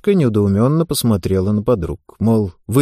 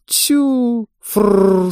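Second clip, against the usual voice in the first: mocking laugh; 9 semitones higher than usual. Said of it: "сквозь смех пронеслись реплики".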